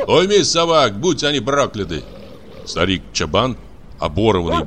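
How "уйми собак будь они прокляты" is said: raised voice, high and cross; angry shouted order. Acted. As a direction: yell a cursing command at the shepherd. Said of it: "крикнул Кузьмичёв чабану".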